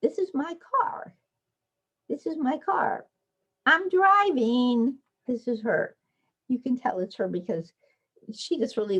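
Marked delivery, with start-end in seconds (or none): 0.0-5.0 put-on high child voice, slowing proudly; child's voice. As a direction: play a kid driving a drawn car. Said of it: "I do the wheels".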